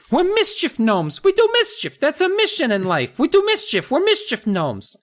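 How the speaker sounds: tiny gnome cutting in; high gnome voice. Acted. Gnomes explaining their nature, very high voice. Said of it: "Hap explained".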